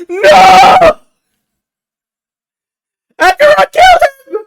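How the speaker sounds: ear-splitting high shriek; mock-grief screaming. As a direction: scream the death news in hammed-up despair. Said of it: "I need an ear replacement".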